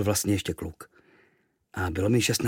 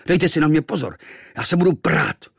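higher, louder angry threat; mild boyish fury; 6 semitones higher, 6 dB louder than usual. Acted. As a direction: puff up and threaten. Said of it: "Rozuřil jsem se".

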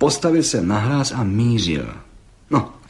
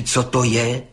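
ordinary delivery, nothing marked

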